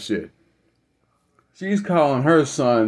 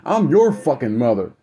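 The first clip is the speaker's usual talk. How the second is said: imitating a threatening mother, high and rapid; high-pitched, rushed mock tirade; in-his-face confrontation; 8 semitones higher than usual. Mother getting in her son's face, confrontational. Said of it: "got in his face and said".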